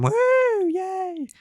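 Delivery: a hammed-up shrill hooray; sarcastic cheer. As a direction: fake a thrilled little yay. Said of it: "so she's like".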